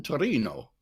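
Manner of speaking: "Torino" said the Italian way, toh-REE-noh; Italian pronunciation. Shown as a demonstration. Guest gives host the Italian name, "Torino" in Italian pronunciation. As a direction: say "Torino" with Italian vowels, stressing the middle. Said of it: "in Italian".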